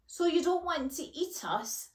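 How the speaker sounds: a touch of wariness; gingerly. A narrator's reading. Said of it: "asked Florence gingerly".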